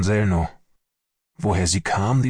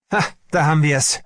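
exclaimed cry, pitched up; shouted outburst; 7 semitones higher, 4 dB louder, faster than usual. A narrator's reading. Army doctor reacts to the medic's bad news, shouted outburst. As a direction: burst out, bitterly vindicated. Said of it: "schrie Sellnow".

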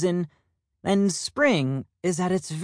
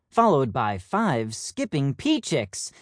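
ordinary delivery, nothing marked

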